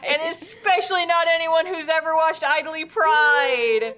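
high, declaimed sarcasm; hammed-up, sing-out sarcastic proclamation; mock astonishment. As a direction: announce it with theatrical fake innocence. Sarcasm about predictable twist.

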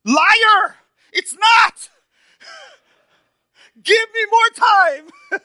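very high, loud comic protest, laughing; mock outraged shout. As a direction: shout back at the nurse in jest. Retold reply to the nurse.

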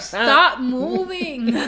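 laughing, drawn out slowly; cracking up while talking. Real, not acted. Laughing at friend's fidgeting.

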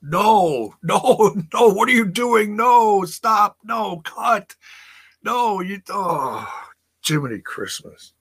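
high, pleading no no no; exasperated protest. Retold or imitated. Fan pleading with the TV, high and exasperated. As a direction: beg them to stop, half laughing. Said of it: "And I was like".

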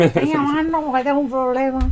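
mock simpleton. Co-host showing how she'd prank-call the police, mock simpleton. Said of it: "Me hago la monger en plan".

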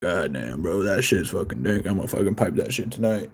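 low, softened impression of a friend; imitating Harvey. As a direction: drop low and quiet, mimicking an impressed buddy. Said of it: "This guy will take ... and be like".

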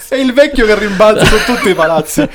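high, loud laughing speech. Real, not acted. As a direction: talk through a fit of laughter. Laughing while telling.

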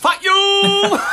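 laughing shout. Real, not acted. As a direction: yell it back through laughter. Laughing outburst at partner's joke.

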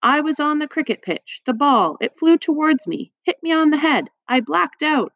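put-on high-pitched boy coming round; high, disjointed muttering to oneself; dazed muttering. A narrator's reading. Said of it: "The boy was muttering to himself".

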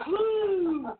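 high whoop; excited whoop. Real, not acted. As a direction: let out a whoop. After laughing fit.

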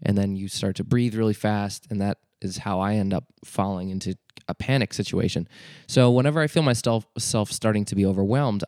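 usual speaking voice

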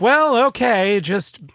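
high, loud mock reaction; acted-out immortal. Host imagines living thousands of years, high-pitched mock reaction.

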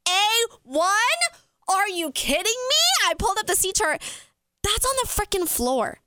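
high-pitched, stretched-out disbelief; squealing retold amazement; incredulous. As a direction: reenact shock, pitch shooting up. Reenacts shock at seat offer.

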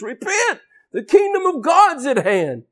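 high-pitched prophet's proclamation; forceful demanding cry. Acted. John preaching repentance in the wilderness, forceful demand. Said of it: "he was demanding in the wilderness".

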